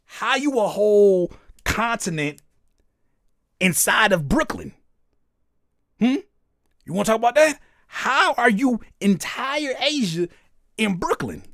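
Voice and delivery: hammed-up mock outrage; mock incredulous. Mock interrogation of commenter.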